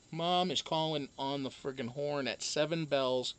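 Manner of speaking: ordinary delivery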